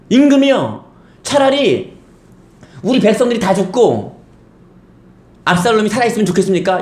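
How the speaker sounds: high, scolding challenge to the king; Joab rebuking. Retold or imitated. General Joab scolding King David for weeping. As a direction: rebuke the grieving king sharply. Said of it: "뭐라는지 아십니까? 요압이".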